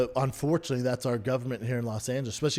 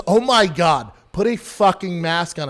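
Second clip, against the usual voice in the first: loud, higher retold scolding; hostile; 4 semitones higher, 7 dB louder, slower than usual. Coffee shop woman ordering a customer to mask up, hostile. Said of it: "she was so hostile".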